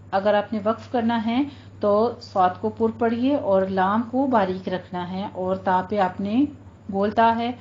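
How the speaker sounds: ordinary talk, nothing marked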